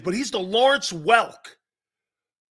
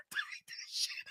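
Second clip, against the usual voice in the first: wheezing with laughter; 17 dB quieter than usual. Real, not acted. Cracking up mid-sentence.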